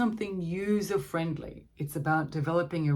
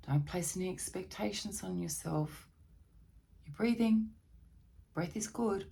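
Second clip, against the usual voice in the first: soft reassurance to an anxious person; soothing calm; 6 dB quieter than usual. Shown as a demonstration. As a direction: soothe someone who is panicking. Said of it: "soothing self-talk".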